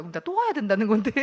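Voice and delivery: mock-indignant complaint, half amused; exasperated. Newcomer fuming inwardly at having to come back, exasperated. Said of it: "이게 너무 화가 나는 거예요".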